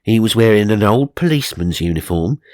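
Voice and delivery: ordinary delivery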